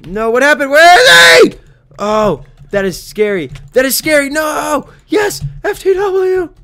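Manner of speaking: high, loud shrieks; panicked shouting. Real, not acted. Player scared then spotting an ally, high shouting. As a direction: shriek in alarm, then relief. Enemies vanish, ally appears.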